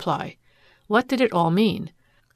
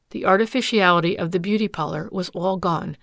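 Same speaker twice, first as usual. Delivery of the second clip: ordinary delivery, nothing marked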